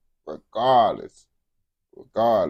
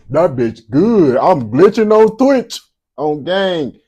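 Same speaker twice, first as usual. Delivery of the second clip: loud, high-pitched rant; heated outburst; 8 semitones higher, 12 dB louder than usual. Real, not acted. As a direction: raise your voice, pitch jumping up. Reactor's heated outburst mid-rant.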